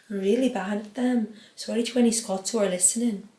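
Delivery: ordinary talk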